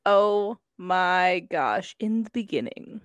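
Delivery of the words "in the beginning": hushed, dramatic trailer intro; movie announcer voice. Pretend. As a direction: open like a movie trailer narrator. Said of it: "That was my best movie announcer voice".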